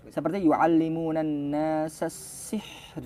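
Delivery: "yu'allimunan nasa sihr" drawn out slowly; slow recitation. Shown as a demonstration. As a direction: recite slowly, ending on a clear ra. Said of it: "bukan sihr". (bacaan lambat "sihr" dengan ra jelas)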